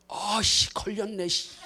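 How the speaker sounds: irritated grumble, caught out; muttered annoyance. Pretend. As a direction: curse under your breath. Zacchaeus annoyed at being caught.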